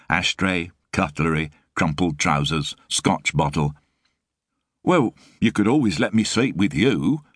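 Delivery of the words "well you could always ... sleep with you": light cheeky offer in Frost's voice; half-joking proposition with a grin. A narrator's reading. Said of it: "he beamed".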